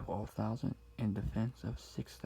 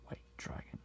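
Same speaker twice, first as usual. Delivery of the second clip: whisper in left ear; 7 dB quieter than usual. Acted.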